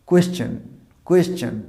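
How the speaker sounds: "কোয়েশ্চেন" as kwes-chan, with a w glide; careful model "কোয়েশ্চেন", kw at the start; correct pronunciation. Shown as a demonstration. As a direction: say "কোয়েশ্চেন" with a kwe- opening. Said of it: "উচ্চারণটা কী বলবো?".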